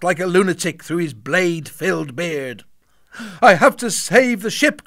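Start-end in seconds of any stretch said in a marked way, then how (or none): 3.4-4.8 higher, louder urgent cry; cried out. A narrator's reading. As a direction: call out with determination. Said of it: "cried Robin".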